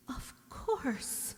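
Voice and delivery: breathless whispered echo; whispered thought. Acted. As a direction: repeat it under your breath. Helen repeats it in thought.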